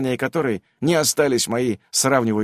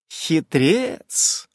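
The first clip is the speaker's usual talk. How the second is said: higher, with a rising end; playful insisting; 4 semitones higher than usual. A narrator's reading. Nasa keeps teasing the player, insistent rising tone. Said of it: "продолжала настаивать Наса".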